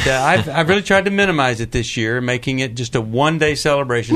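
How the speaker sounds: usual speaking voice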